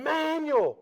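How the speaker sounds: high, loud frantic yell; screaming a name. Retold or imitated. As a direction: yell his name in panic. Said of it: "She starts screaming".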